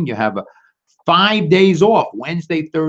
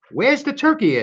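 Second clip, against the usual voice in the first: high wife voice; 11 semitones higher than usual. Acted. Wife asks.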